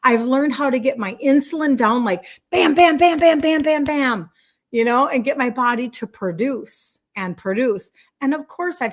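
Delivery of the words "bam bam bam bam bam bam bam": high, loud string of bams; punchy machine-gun sound effect; rapid-fire bams. Shown as a demonstration. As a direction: fire off each bam like a punch. Imitates rapid results.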